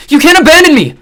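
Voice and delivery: loud, high desperate scream. A narrator's reading. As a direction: scream in despair. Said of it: "screaming as many expletives".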